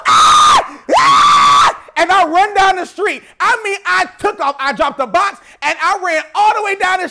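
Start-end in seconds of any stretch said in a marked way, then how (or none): 0.1-1.8 high, very loud screams of joy; joyful scream. Retold or imitated. Opening the gift box, screaming with joy. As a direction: scream out in delight. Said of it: "And I go".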